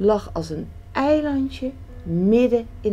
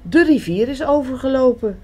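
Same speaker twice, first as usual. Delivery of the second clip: higher, louder and fast, urgent; calling out; 7 semitones higher, 5 dB louder, faster than usual. A narrator's reading. Frog calling to Duck about the river, urgent. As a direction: shout the news of the flood. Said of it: "riep Kikker".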